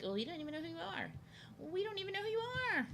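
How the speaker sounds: high, quick, soft put-on voice; mocking imitation. Famous creators dismissing the speaker, mocking high voice. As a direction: mimic them in a squeaky rush. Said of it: "they'd be like".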